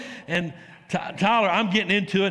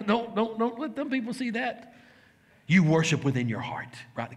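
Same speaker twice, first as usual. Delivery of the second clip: Satan's sneaky whisper; 4 semitones higher, 4 dB quieter than usual. Acted.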